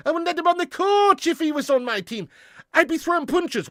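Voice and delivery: high, put-on Irish accent, growling disapproval; Roy Keane impression. Fan on stream imitating Roy Keane in a put-on Irish accent. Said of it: "Roy Keane".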